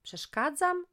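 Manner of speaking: high, loud indignant question; outraged mother throwing his word back; mother stunned by her son's cheek, high and loud; indignant echo. Acted.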